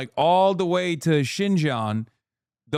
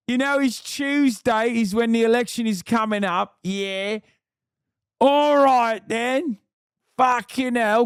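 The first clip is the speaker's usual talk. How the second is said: high, hammed-up Cockney voice; a cheeky London geezer pitching votes; mock British accent; 10 semitones higher than usual. Streamer mocking candidate in British accent. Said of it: "Wrong accent?".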